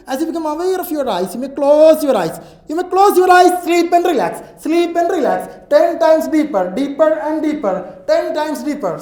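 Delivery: loud, high commanding sleep order; hypnotic command. Acted. (ഉറക്കെ ആജ്ഞാപിക്കുന്ന സ്വരം)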